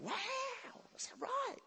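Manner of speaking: high, soft astonished question; hushed mock-amazed reaction; acted amazement. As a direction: ask it in quiet, high-voiced wonder. Acts out amazed reply to God.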